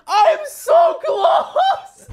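high, drawn-out shout; frustrated yell. Real, not acted. Player to viewers after a near miss, frustrated yell. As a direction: wail it loud and high, stretching the words.

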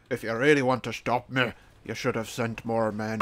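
low film tough-guy impression; Liam Neeson. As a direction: drop low and threaten, amateur actor impression. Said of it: "Liam Neeson accent, go".

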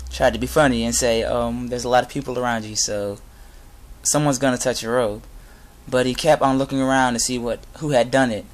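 normal talking voice

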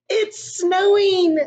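put-on a kid almost bursting with joy; eager little boy. Excited little boy in story.

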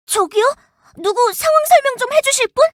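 puzzled shout for help; calling out. Acted. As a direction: call out to nearby teammates. Confused calling out to others.

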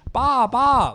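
high, loud imitated child's cry; little kid calling. Teller mimics a kid outside the toilet, high childlike calling. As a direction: pipe it up like a small kid, loudly. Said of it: "Like, a little kid saying it".

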